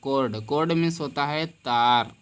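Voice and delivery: usual speaking voice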